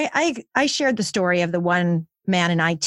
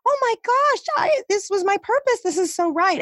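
much higher, gushing joyful exclaim; a delighted parent hearing their baby praised; thrilled realization; 12 semitones higher than usual. Retold or imitated. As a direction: overflow with happy discovery. Feeling validated about purpose.

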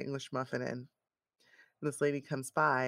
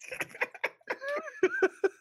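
high long laugh of delight. Real, not acted. Friend loving the story of lost filter, delighted laugh. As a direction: laugh with real glee.

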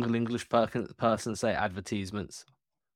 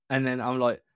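normal talking voice

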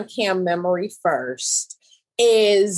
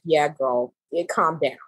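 laid-back calming; 8 semitones lower than usual. Retold or imitated. David calming her down.

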